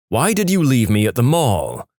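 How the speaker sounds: ordinary delivery, nothing marked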